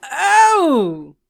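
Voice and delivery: long high "Oh!", swooping down; drawn-out, high joke shout; exclaimed name. Pretend. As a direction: echo the playful holler loudly. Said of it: "can't say her name without doing that".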